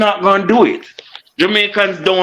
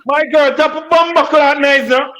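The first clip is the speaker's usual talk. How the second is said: high-pitched exasperated rebuke; angry scolding; 9 semitones higher than usual. Real, not acted. Man scolding the woman talking over him, exasperated. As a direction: cut her off, pitch jumping up.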